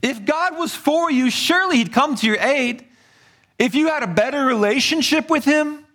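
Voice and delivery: high-pitched, needling taunt; heckling. Acted. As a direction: act out a scornful heckler. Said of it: "by people heckling him".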